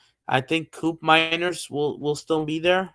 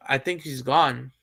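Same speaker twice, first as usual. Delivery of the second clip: ordinary talk, nothing marked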